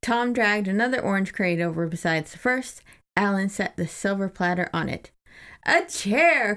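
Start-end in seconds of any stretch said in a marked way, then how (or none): 5.6-6.5 higher, excited cry of a waiter; cried out. A narrator's reading. Narrator voices Alan calling for a chair, excited. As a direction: call it out like a grand waiter. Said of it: "cried Alan".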